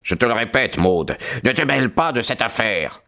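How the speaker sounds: ordinary delivery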